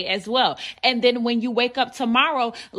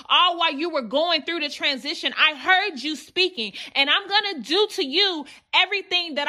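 building sermon cadence, a bit higher; preaching; 4 semitones higher than usual. Acted. Preacher drives the point home to the congregation, fervent. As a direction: press each "before you saw" and "what were you saying" harder. Rising preaching toward climax.

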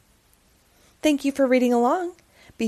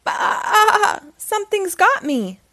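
higher, louder startled bleat; mock fright; 9 semitones higher, 4 dB louder, slower than usual. Wilford snagged by the sheet, startled bleat. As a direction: yelp in alarm. Sheep caught.

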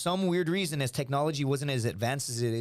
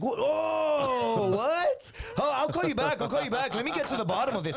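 high, hammed-up alarmed cry; exaggerated phone-call astonishment; feigned shock; 10 semitones higher than usual. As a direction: play shocked, then hurry off the call. Caught out, acts shocked on phone.

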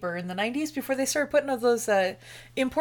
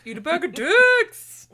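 mock shout; 3 semitones higher, 8 dB louder than usual. Shouting at old roommates.

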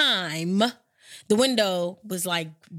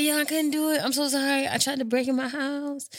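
apologetic; 6 semitones higher than usual. Retold or imitated. Apologetic self-quote.

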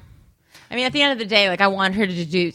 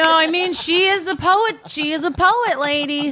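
high, emphatic insistence; excited; 9 semitones higher than usual. Real, not acted. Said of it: "excited insistence".

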